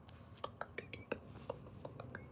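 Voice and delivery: breathless, barely audible sorry; tiny voiceless apology; whispered apology. Real, not acted. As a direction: whisper it, all voice gone. Spent from laughing, apologising.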